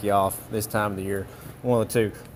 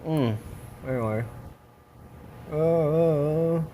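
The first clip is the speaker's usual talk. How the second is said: mouth propped open; 2 semitones higher, 5 dB quieter, slower than usual. Real, not acted. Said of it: "We put a flashlight in my mouth".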